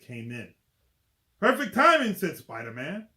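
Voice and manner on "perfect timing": high, loud and punchy; cocky hero quip. Acted. Spider-Man greeting the lizard, loud and cocky. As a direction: fire the quip off loudly.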